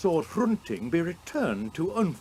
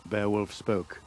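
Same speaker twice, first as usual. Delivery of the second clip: ordinary delivery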